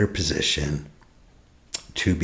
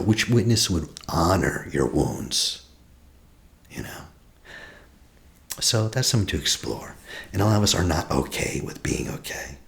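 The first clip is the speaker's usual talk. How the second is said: normal talking voice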